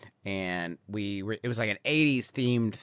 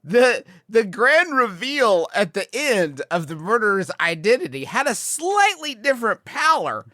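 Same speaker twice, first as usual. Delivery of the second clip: higher and louder, laughing between words; amused; 10 semitones higher, 9 dB louder than usual. Real, not acted. Recounting the murder reveal to friends, amused. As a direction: tell it while cracking up. Telling the story while amused.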